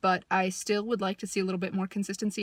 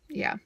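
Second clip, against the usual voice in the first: tired sigh; 7 semitones higher than usual. Real, not acted. Sighing after a musing rant.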